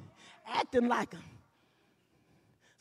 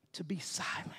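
whisper; 6 semitones lower, 9 dB quieter than usual. Acted. Whispered dramatic ending.

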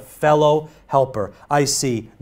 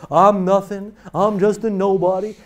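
mild false modesty; 4 semitones higher than usual. Retold or imitated. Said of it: "that excessive".

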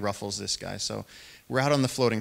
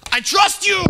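very high, loud battle cry; hyped-up shout; 11 dB louder, slower than usual. Retold or imitated. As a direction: yell it as you charge in. Jonas before jumping on snake.

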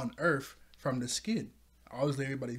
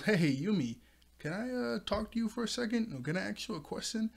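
imitating Oric; 8 semitones higher than usual. Oric voiced in the retelling.

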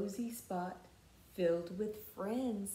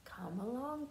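plain reading voice

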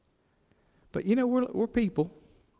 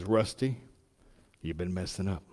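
a mock God voice, lower and softer; 7 semitones lower, 5 dB quieter than usual. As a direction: play God scolding, hushed and deep. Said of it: "in a God voice".

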